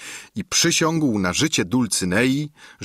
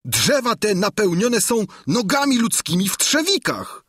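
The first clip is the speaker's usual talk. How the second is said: high-pitched alarmed squire; frightened high character voice; Sancho, frightened; 12 semitones higher than usual. A narrator's reading. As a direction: report it in a high, scared voice. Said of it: "odrzekł Sancho".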